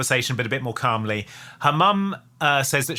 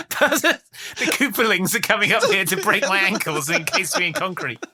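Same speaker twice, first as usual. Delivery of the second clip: mock Italian mobster; 7 semitones higher than usual. Mock mafia dad.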